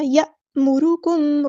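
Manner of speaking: यअमुरुकुम with a glottal jolt on hamza, slow; correct reading. Shown as a demonstration. Teacher showing the right jolt, slow. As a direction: recite यअमुरुकुम with a clear catch. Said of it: "हमज़ा साकिन है तो यहाँ पे हम झटका देंगे".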